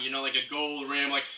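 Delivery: ordinary talk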